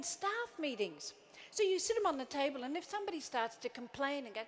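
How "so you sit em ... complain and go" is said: ordinary talk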